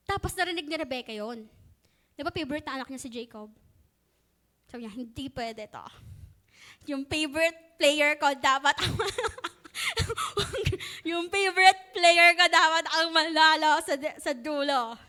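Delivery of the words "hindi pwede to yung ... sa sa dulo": playful mock mother, louder; joking mother. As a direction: jokingly cheer your favorite. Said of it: "joke lang yun". (nagbibirong ina)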